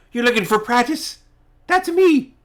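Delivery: very high, delighted squeaky character voice; really happy old man. Acted. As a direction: squeal it with joy as the little hermit. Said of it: "he's, like, really happy".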